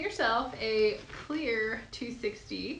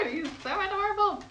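gushing; 8 semitones higher than usual. Real, not acted. Gushing over finished balloon bee.

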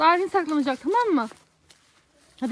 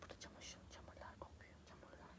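whispering while hiding; 34 dB quieter than usual. Real, not acted.

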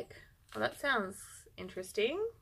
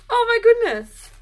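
excited gasp; 11 semitones higher, 6 dB louder than usual. Real, not acted. Still reacting to more skeins.

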